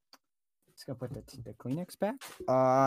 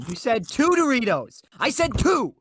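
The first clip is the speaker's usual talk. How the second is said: higher and louder, indignant accusing; hammed-up indignation over a snack; mock outrage; 8 semitones higher, 5 dB louder than usual. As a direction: call him out for the extra Dorito, outraged. Accusing friend of third Dorito.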